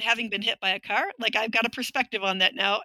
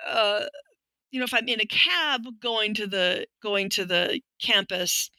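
ordinary talk

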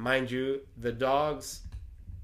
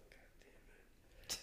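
barely audible whispered cursing; frightened whisper; 22 dB quieter, slower than usual. Retold or imitated. Man alone hearing knocks on the door, whispered dread. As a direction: curse under your breath, all whisper.

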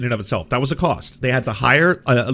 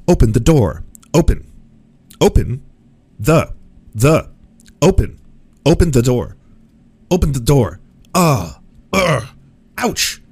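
loud, punchy falling delivery, each line separate; video game barks; 2 semitones higher, 7 dB louder than usual. Shown as a demonstration. Voice actor grinding through small little lines in the studio, loud game barks. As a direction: belt out isolated game lines and effort noises.

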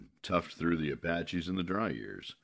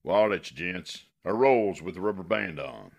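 gruff warning; 4 semitones higher, 9 dB louder than usual. Acted. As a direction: growl a threat at the passengers. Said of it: "he warned".

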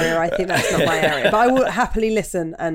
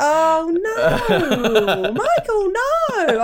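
high laughing no; laughing protest; 11 semitones higher, 4 dB louder, much slower than usual. Real, not acted. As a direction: squeal it out through laughter.

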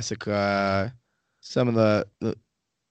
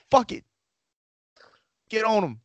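high-pitched imitation of English locals; mock English accent; 10 semitones higher than usual. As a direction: act out English lads in a put-on accent. Retold Englishmen deciding to attack.

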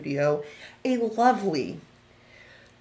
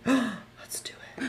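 breathy excited whisper, slow; 4 semitones higher, 6 dB quieter, slower than usual. Real, not acted. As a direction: gasp then whisper it. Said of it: "she's giving me the eyebrows".

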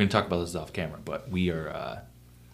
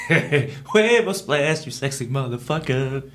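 slow, stretched comic impression through a laugh; Pablo Francisco bit; 4 semitones higher, slower than usual. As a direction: quote the comedian's bit, laughing. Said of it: "Pablo Francisco".

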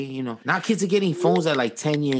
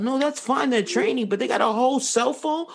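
much higher, pushing hard with disbelief; heated outburst; 9 semitones higher than usual. Real, not acted. Heated argument about kids' phones.

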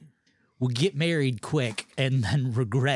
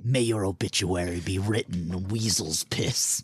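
deep voice; 6 semitones lower than usual. Acted. Reading curses in deep voice.

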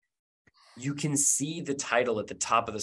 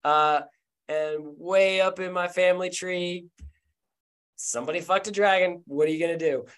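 higher, breezy character voice; Charlie's voice; 5 semitones higher than usual. Acted. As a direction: shrug it off casually.

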